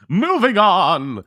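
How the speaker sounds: high, drawn-out cut-off; emphatic topic change. Real, not acted. Cutting off the co-host's beer argument, emphatic topic change. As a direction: impatiently shut the argument down, stretching it out. Cuts off soft drink argument.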